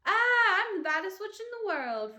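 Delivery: high, loud triumphant witch cry; little witch shrieking in triumph; carried-away witch shout. A narrator's reading. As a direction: scream it in wild glee.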